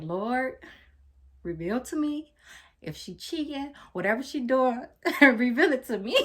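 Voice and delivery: hushed, pleading prayer that breaks into a laugh; nervous prayer. Retold or imitated. Alone on the bathroom floor before searching her phone, nervous mock prayer. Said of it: "praying because I was shaking and I was nervous".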